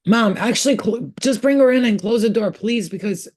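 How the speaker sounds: high, loud call across the house; called out to someone off-mic; calling out to mom. Real, not acted. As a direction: raise the voice to reach mom in another room.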